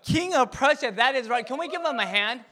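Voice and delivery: loud, high hype for the student; excited praise. Real, not acted. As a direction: pump the crowd up to applaud him. Praising student, rallying applause.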